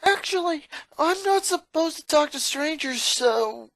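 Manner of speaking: put-on boy trying to talk his way out; sheepish excuse. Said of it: "Lincoln smiled sheepishly".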